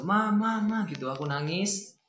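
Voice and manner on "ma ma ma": high, louder cries for mum; retold shouting. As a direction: reenact a child calling out to mum. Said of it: "Teriak dari dalam". (menirukan anak berteriak memanggil ibu)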